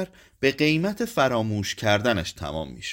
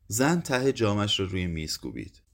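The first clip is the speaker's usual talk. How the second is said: plain reading voice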